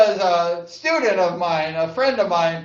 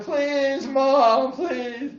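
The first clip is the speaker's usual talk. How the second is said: put-on high-pitched kid's plea; imitating begging; 6 semitones higher, much slower than usual. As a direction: plead with mom, high and drawn out. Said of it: "I'm begging her".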